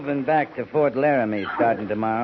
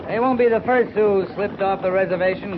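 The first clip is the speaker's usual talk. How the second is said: ordinary talk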